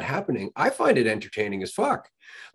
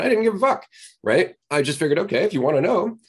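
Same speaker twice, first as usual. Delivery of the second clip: ordinary talk, nothing marked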